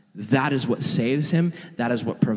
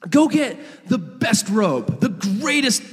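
high, excited calling out; calling servants; 7 semitones higher than usual. Acted. Happy dad orders a feast from servants, calling out. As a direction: summon the household with joyful orders. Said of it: "he calls his servants".

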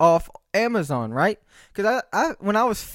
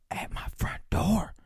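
softer, whispered aside; 3 semitones higher, 7 dB quieter than usual. Real, not acted. Telling viewers where packages land, whispered aside. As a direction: drop to a slow whisper. Complaining about deliveries.

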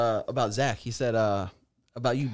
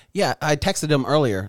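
normal talking voice

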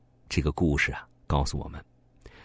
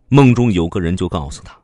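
plain reading voice

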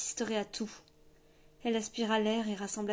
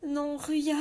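moaning plea; 5 semitones higher, 5 dB louder than usual. A narrator's reading. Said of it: "gémit Elinor".

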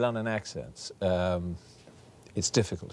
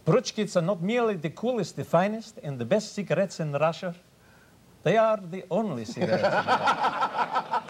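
high-pitched Russian cigarette announcer; mock Soviet commercial, Russian accent pitched up; Russian advert accent; 9 semitones higher than usual. Shown as a demonstration. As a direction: proclaim the product in a hammed-up Russian accent. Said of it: "Even in Russia, I did one once".

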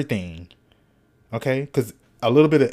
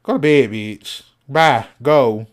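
louder bossy command to herself; scolding herself; 6 dB louder than usual. Shown as a demonstration. She orders herself out of the kitchen at 2 AM, bossy and loud. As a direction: shoo yourself out of the kitchen. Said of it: "I have to talk to myself like that".

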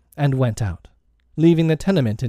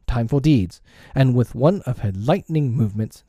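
ordinary delivery, nothing marked

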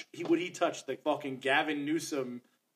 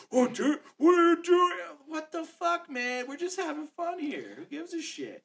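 higher, louder mock outrage; imitating angry friend; 13 semitones higher, 5 dB louder than usual. Mocking his annoyed podcast partner, higher, louder mock outrage. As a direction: ham up a friend's huffy complaint. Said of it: "he's mad at me".

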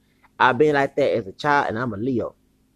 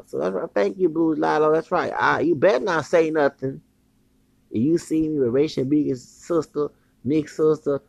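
ordinary talk, nothing marked

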